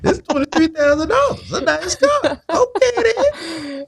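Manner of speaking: high, laughing mock disbelief; high-pitched laughing "okay, then"; laughing tease. Real, not acted. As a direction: tease her about the pricey car. Teasing caller about her car.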